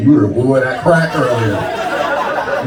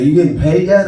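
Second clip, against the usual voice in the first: aunt voice; 8 semitones higher, 4 dB louder than usual. Retold or imitated. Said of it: "She's like".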